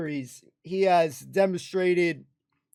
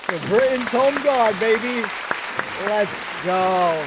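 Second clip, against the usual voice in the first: loud, hyped-up rallying cry, pitched up; excited toast; 3 semitones higher, 6 dB louder, slower than usual. Real, not acted. Fan cheering the Home Guard toast back at the screen, loud and excited.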